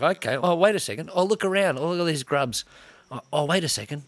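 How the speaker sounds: high, dawning surprise at himself; mock realisation. A man in court realising he's a grub, mock surprise.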